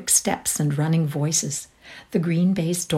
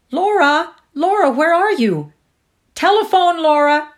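crying out; 11 semitones higher, 9 dB louder than usual. A narrator's reading. Someone in the house summoning Laura to the telephone, crying out. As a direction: call her in from a distance. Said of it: "a voice cried from the house".

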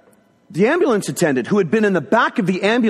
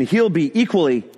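ordinary delivery

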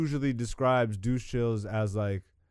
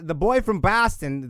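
put-on loud, high, terrible Boston accent; hammed-up fake Boston twang; bad Boston accent; 6 semitones higher, 10 dB louder than usual. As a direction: belt out a botched Boston accent. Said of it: "the worst Boston accent".

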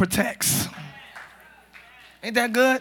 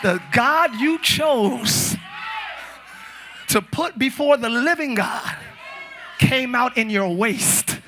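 fiery preaching; slower than usual. Acted. Preaching at full force.

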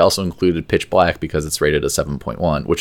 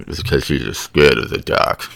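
lightly lowered tough-guy voice, cheeks pinched; movie character impression; 4 semitones lower than usual. Co-host repeats his impression for laughing friends, low pinched-cheek voice. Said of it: "I need to pinch my cheeks".

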